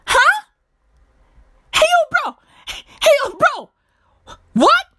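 high-pitched stunned disbelief; incredulous. Real, not acted. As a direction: squeal it in short baffled bursts. Stunned by the misspelling.

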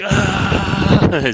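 sudden high, loud joyful scream; imitated scream. Retelling the family's scream in the background, a high loud shriek. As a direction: shriek like someone hearing great news. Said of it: "And there's just the scream".

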